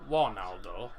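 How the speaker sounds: "one, Aldo" with a w for the r; says w for r. Real, not acted. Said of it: "I can't pronounce me R's".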